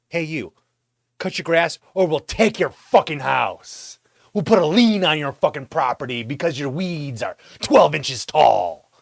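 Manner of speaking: a bully of a city office, spelling out the threat; threatening city letter. Retold or imitated. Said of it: "they're like".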